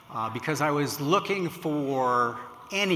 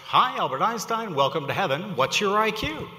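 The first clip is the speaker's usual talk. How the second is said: raised, louder cheery welcome; chipper Einstein greeting; 6 semitones higher, 5 dB louder than usual. Acted. Einstein welcoming a newcomer to heaven, chipper and loud. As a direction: greet a newcomer brightly, pitch lifted.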